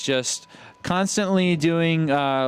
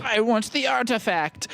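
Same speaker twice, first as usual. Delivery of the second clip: mock villain; 9 semitones higher than usual. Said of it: "I don't want a refund".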